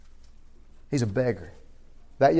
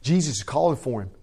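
normal talking voice

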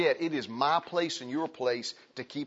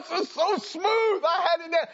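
husband's high, loud lament that his plan got derailed; mock frustrated husband; 6 dB louder than usual. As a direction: whine it out like a thwarted husband. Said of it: "And he's like".

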